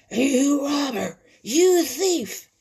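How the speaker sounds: loud, high, slow accusation; storybook bird screaming insults; harsh scream. A narrator's reading. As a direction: scream it harshly as the furious heron. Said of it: "he screamed harshly at old Whitetail".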